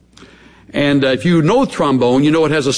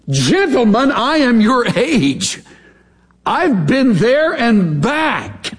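slow defiant; 9 semitones higher, much slower than usual. Retold or imitated. Job rebukes friends.

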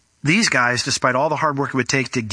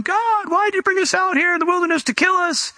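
mock crying complaint. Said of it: "all the people are crying".

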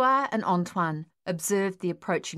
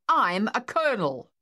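loud statement; 4 semitones higher, 4 dB louder than usual. Acted. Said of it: "the officer stated loudly".

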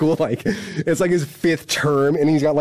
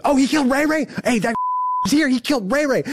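very high mock holler; retold yell, shooting up in pitch; imitated yell; 12 semitones higher than usual. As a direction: imitate another inmate yelling across the block. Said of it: "someone else yells".

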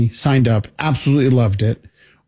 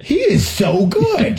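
amazed; 9 semitones higher, much slower than usual. Real, not acted. Amazed by card trick.

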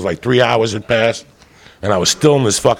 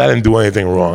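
ordinary talk, nothing marked

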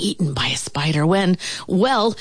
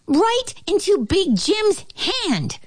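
high, squeaky interjection; flea's retort; 13 semitones higher than usual. A narrator's reading. Flea finishes ant's boast, high squeaky retort. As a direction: cut in with a high little voice. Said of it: "said the flea".

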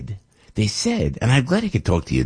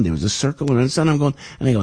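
normal talking voice

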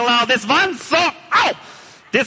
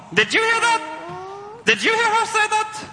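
mock indignation; 12 semitones higher than usual. Villain outraged.

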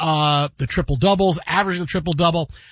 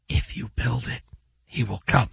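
second take of a hushed, low whisper; 7 semitones lower, 5 dB quieter than usual. Acted. Host retries the movie's whisper on request, whispering. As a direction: whisper it low, like a mysterious summons. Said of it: "a little bit too guttural, not, not enough power".

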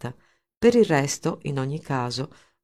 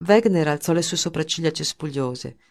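plain reading voice

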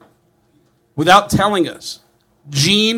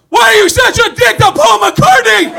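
much higher, loud mock yell; yelling stranger; 6 dB louder than usual. Stranger yelling at Gene in a mall, high mock yell. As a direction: heckle him in a shrill mall yell. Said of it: "people started yelling at him".

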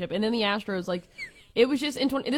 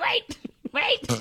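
high-pitched mock panicked plea; mock alarm. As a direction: retell a startled protest, pitched way up. Retelling being grabbed in celebration.

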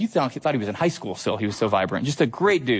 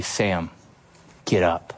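lower, tender command to rise; Jesus' Galilean voice; 6 semitones lower, slower than usual. Retold or imitated. Preacher voicing Jesus calling Sam, lower and tender. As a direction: call the dead man up gently. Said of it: "in his northern Galilean voice".

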